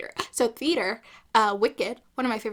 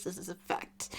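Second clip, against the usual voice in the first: hushed emphasis; 5 semitones lower, 11 dB quieter than usual. Real, not acted. Repeated for emphasis.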